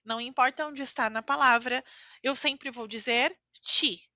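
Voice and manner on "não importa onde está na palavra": ordinary talk, nothing marked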